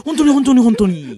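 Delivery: climbs to high, loud whiny pleading; Soshina's flippant follow-up. Acted. Comedian lightly excusing his cruel joke, high pleading impression. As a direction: brush it off, voice shooting up. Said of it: "軽すぎるフォローを入れる".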